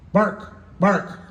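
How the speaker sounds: high-pitched comic doggy bark; talking dog. Acted. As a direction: say the word like a dog barking. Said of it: "And the dog goes".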